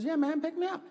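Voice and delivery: put-on very high little kid voice; squeaky-high imitation of a pleading small son; six-year-old boy. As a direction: put on a child's voice, far above your own. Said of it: "He goes".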